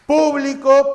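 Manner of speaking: "Público" stressed on PÚ; high, loud first syllable in "Público". Shown as a demonstration. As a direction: hit the start of "Público". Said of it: "El acento señala a la mayor fuerza de voz".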